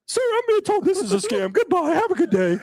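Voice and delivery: very high, prim voice with a laugh; mock-meek high-pitched goodbye; polite Cody. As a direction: squeak it out politely, barely holding a laugh. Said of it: "I don't think my voice got that high".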